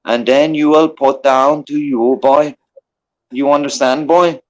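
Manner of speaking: lightly faked Irish tough guy giving mock orders; put-on Irish accent. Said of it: "I tried to make up a good accent".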